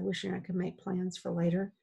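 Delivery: usual speaking voice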